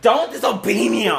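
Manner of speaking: loud, high angry shout; growled. Acted. Steve shouting an order at his creation. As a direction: bark the order. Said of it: "Steve growled".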